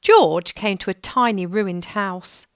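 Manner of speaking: usual speaking voice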